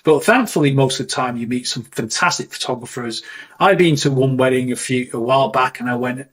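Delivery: normal talking voice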